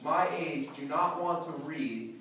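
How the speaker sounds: ordinary talk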